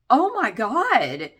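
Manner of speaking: drawn-out horrified gasp of words; slow dismayed oh my God. Retold or imitated. Recalling ex's tattoo reveal, slow dismay. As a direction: stretch it out in dismay.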